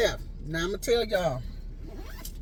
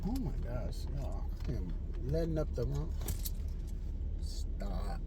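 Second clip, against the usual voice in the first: much softer, lower aside; real hushed whisper to yourself; 7 semitones lower, 13 dB quieter than usual. Real, not acted. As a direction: drop your voice to a hush at the slip. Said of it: "mistake while recording".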